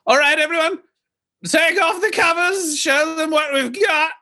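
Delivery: very high, gleeful calling-out; flamboyant host announcing. Acted. Heir orders servants to uncover trays, high theatrical call. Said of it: "And he says".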